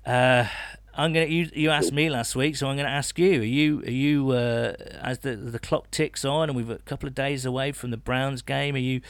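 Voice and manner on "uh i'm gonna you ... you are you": usual speaking voice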